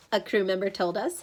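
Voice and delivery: plain reading voice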